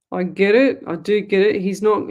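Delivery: ordinary talk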